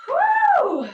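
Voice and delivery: loud high whoop of relief; relieved whoop. Real, not acted. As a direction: let it all out with a big whew. Relief after a long rant.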